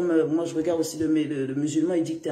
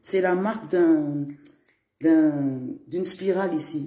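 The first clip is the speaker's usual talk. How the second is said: usual speaking voice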